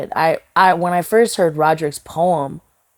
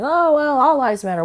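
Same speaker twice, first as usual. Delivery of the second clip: higher-pitched mock objection; imitating dismissive objectors; 10 semitones higher than usual. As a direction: quote the objectors in a raised, put-on pitch. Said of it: "and people saying".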